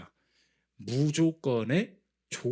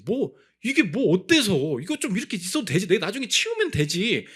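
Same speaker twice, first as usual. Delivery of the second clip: breezy dismissal; 5 semitones higher, 4 dB louder than usual. Retold or imitated. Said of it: "너무 쉽게 얘기했어요".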